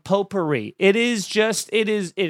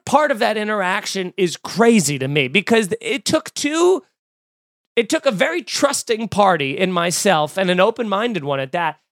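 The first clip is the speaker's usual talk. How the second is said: ordinary talk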